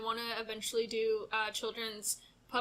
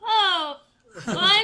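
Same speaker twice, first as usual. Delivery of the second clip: awkward high sympathy; 9 semitones higher, 9 dB louder, slower than usual. Retold or imitated. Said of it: "I'm like".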